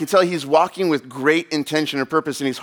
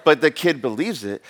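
normal talking voice

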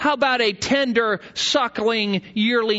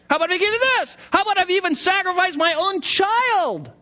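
heightening, pleading higher; 10 semitones higher, faster than usual. Shown as a demonstration. Worshipper bargaining with God, pleading higher and higher. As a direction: push the bargain up, higher and more desperate. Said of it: "he's heightening".

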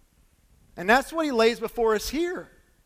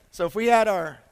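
ordinary talk

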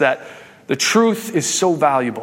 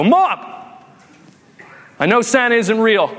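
put-on higher-pitched kid calling out Mom; confronting child; 6 semitones higher than usual. Kid confronts mom over Santa at breakfast, confronting child voice. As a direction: accuse your mom like an indignant kid, acted out.

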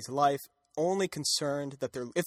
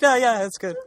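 loud, higher-pitched mock brush-off; 8 semitones higher, 8 dB louder than usual. Supergirl shooing off passersby while spying, mock brush-off. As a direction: act out hastily waving someone away, pitched up and loud.

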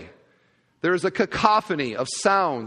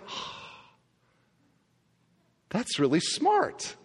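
impressed; 3 semitones higher, 5 dB quieter, slower than usual. Retold or imitated. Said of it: "I was like".

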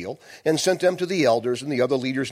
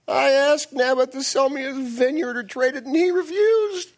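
whining Ahab; 10 semitones higher than usual. Acted. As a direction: whine it like a pouting weenie king. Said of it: "he's whining".

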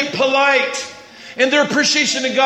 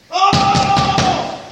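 long, high held cry; 7 semitones higher than usual. Acted. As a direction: cry out in one drawn-out note. Acting out loud praise of God.

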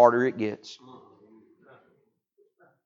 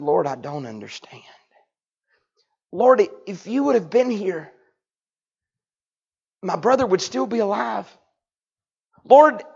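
higher, pleading tone building to a broken hush; Mary grieving her brother before Jesus, pleading; grieving plea; 8 semitones higher than usual. Acted. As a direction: plead with Jesus through grief, fading to a whisper. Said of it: "in a different tone".

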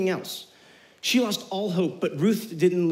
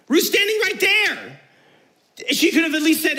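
indignant; 9 semitones higher, 5 dB louder than usual. Real, not acted. Preacher indignant at Naomi's silence.

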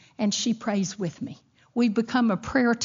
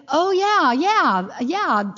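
caught-off-guard yeah; 6 semitones higher, 4 dB louder, much slower than usual. Retold or imitated. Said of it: "you know, shock".

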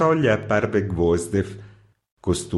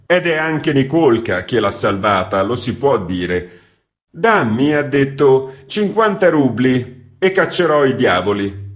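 lightly voiced editor, a bit higher and agitated; editor telling the tale; 5 semitones higher, 6 dB louder than usual. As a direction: recount the prank with mounting indignation. Editor recounting the devil story. (redattore che racconta, un po' agitato)

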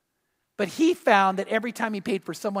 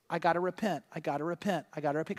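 softer each time, fading; repeating to himself; 4 semitones lower, 10 dB quieter, faster than usual. Retold or imitated. Preacher telling himself to repent, fading soft. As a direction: murmur it fading away. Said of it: "having to say".

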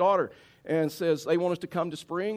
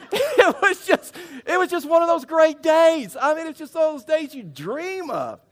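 very high, loud gushing; hammed-up squeal of delight; over-the-top elation; 9 dB louder than usual. Preacher raving about his perfect day, hammed-up elation.

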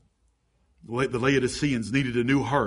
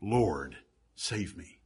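softer, lower, barely above a breath; hushed plea; 6 semitones lower, 5 dB quieter, much slower than usual. Retold or imitated. Peter sinking in the sea, hushed plea. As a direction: murmur it like a man going under. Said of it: "Peter ... said".

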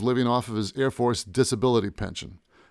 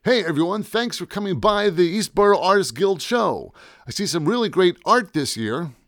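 higher, hammed-up event host; announcer voice; 7 semitones higher, 4 dB louder than usual. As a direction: welcome the crowd in a big announcer voice. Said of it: "In my best announcer voice".

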